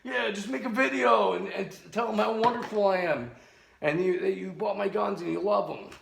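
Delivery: high-pitched mocking sales pitch; mock shill recruiter. Seller asking strangers to talk good about him, mocking high imitation. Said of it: "he's gotta hire strangers".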